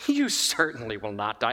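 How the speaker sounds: a low reassuring serpent. Retold or imitated. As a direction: purr it like a tempting snake. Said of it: "I'm using my own inflections".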